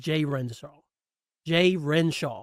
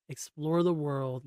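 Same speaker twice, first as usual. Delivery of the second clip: usual speaking voice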